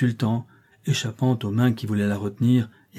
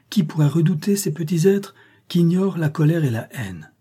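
earnest, raised plea; pleading; 6 semitones higher than usual. Acted. Mother defends her little children, pleading. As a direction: plead in a higher voice.

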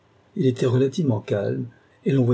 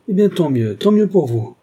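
louder, higher, feverish exclamation; raised voice, frantic and insistent; agitated relief; 7 semitones higher, 7 dB louder than usual. A narrator's reading. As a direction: blurt it out with intense, nervous relief. Agitated old man's dramatic relief.

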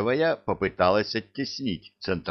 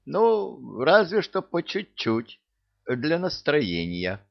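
much higher, playful give-in; high teasing relenting; 7 semitones higher than usual. Acted. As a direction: relent with a sly lilt.

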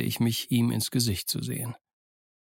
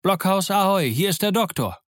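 high, loud hail from afar; shouting a greeting from the edge of the woods; calling out loud; 8 semitones higher, 3 dB louder than usual. A narrator's reading. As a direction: call across the clearing. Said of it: "laute Stimme, die uns vom Waldsaum her anrief".

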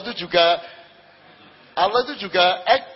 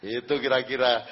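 usual speaking voice

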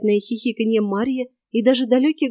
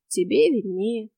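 slow, drawn-out indifferent reply; slower than usual. A narrator's reading. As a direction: shrug it off slowly. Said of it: "равнодушно ответил незнакомец". (равнодушно, протяжно)

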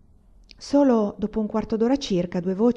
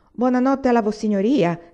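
raised, louder mock-polite greeting; teasing call; 5 semitones higher, 5 dB louder than usual. A narrator's reading. Agata greeting the young man over the wall, teasing. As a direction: call out with playful deference.

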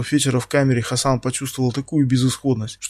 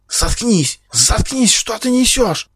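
high-pitched frantic outcry; hysterical shout; 10 semitones higher, 3 dB louder, much slower than usual. A narrator's reading. Captive silences her captor, shouting hysterically. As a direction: shriek at them to stop, rising higher. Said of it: "истерично выкрикнула Хромцова".

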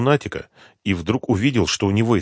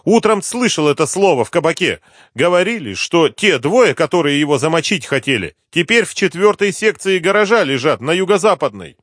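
higher-pitched voice of a pleading snitch; anxious addict informant; 10 semitones higher, 6 dB louder than usual. Acted. Addict tells the inspector about hidden bodies, high insistent voice. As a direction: play the informant eager to be believed.

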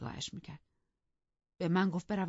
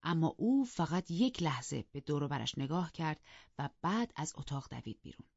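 plain reading voice

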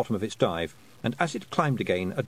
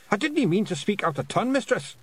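high, frightened Scottish apology; terrified pictsie; 12 semitones higher than usual. A narrator's reading. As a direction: back off apologising in a high Scots voice. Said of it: "changed to a look of terror".

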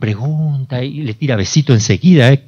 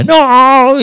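a shrill, much higher and louder demonic scream of no; possessed voice crying out in terror; 14 semitones higher, 6 dB louder than usual. Retold or imitated. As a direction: shriek it as the demon touched by the baby's hand. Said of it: "y le tocó y gritaba".

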